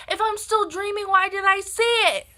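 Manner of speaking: high, loud mock yell; indignant retort. Daughter disputing that she was dreaming, high indignant yell. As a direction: fire it back in shrieking disbelief. Retold argument with mom.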